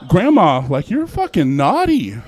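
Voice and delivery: loud, slow, teasing purr; hammed-up seductive scold; loud mock flirty. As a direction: tease her like a naughty lover. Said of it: "So I was like".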